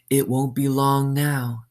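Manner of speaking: cold warden. Acted. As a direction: say it like she's savoring the wait. Warden waiting coldly.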